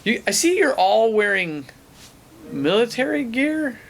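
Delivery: imitating the ranger. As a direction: voice the nosy ranger up high. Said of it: "He's like".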